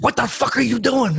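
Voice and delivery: high-pitched indignant demand; mock outrage, voice jumping up; offended outburst, retold. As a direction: imitate an affronted comic confronting a heckler. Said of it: "he was really offended".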